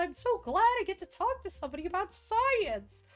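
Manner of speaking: very high, eager falsetto; Reed thrilled to talk science, high and eager; gushing nerd Reed. Retold or imitated. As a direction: gush about science like a happy nerd. Voicing Reed as nerdy.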